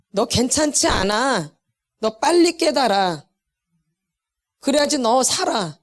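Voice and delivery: slow, deliberate warning; measured admonition with long pauses; God's warning. Acted. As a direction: spell it out slowly, phrase by phrase. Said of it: "그러는 거예요".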